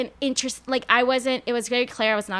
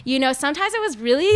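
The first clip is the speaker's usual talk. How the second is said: normal talking voice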